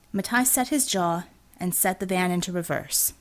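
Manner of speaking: usual speaking voice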